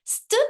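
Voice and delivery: "St" as a bare s-t blend, cut off from the vowel; just the sounds "St", standing alone; isolated onset. Shown as a demonstration. Teacher isolating the onset of stop, bare "St" blend. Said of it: "é o onset".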